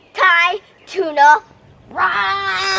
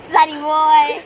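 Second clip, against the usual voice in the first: ordinary talk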